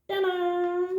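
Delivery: high, sing-song ta-da; triumphant reveal. Pretend. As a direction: announce the reveal with flourish. Reveals the plant.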